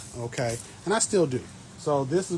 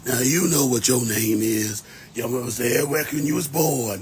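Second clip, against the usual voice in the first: put-on old elder voice; 4 dB louder than usual. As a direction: play an over-fifty relative laying down the law. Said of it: "over fifty, I'm just gonna start talking like this".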